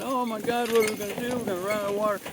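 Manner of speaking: high, mocking whine of helpless worriers; mock whining. Rancher mocking people who fret about drought, in a high whiny voice. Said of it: "sniveling and whining and saying".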